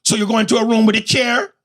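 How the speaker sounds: high, insistent Jamaican questioning; Jamaican woman. Retold or imitated. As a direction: press him in a Jamaican accent. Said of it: "Three times she asked me".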